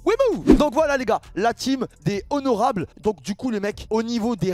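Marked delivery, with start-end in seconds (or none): 0.1-0.5 sudden high, loud whoop; excited whoop. Real, not acted. Streamer rallies his family of viewers, excited whoop.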